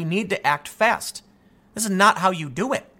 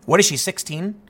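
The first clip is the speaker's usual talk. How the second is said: normal talking voice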